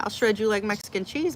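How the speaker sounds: usual speaking voice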